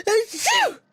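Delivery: gasp then loud sneeze. Acted. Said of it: "Bless you".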